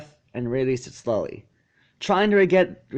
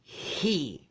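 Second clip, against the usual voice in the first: snarled; 3 semitones higher, 7 dB quieter than usual. A narrator's reading. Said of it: "snarled Scootaloo".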